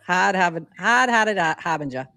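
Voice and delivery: thick Massachusetts accent, r's dropped; old Boston accent. Shown as a demonstration. Podcaster repeating the baffling comment in her old Massachusetts accent. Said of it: "that's how I would say that".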